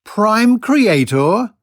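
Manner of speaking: high, slow disbelieving question; incredulous echo. Real, not acted. Skeptic host echoing the channeler's claim, incredulous. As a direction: repeat the phrase as if it were absurd.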